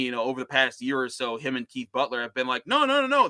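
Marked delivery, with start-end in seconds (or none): 2.7-3.3 high, loud rapid-fire denial; high-pitched string of protesting noes; mock protest. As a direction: imitate someone hurriedly waving off credit. Mimicking two coaches passing credit.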